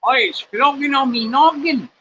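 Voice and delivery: high, loud mock Australian accent; a jokey Aussie exclamation, hammed up. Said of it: "I'm turning Australian".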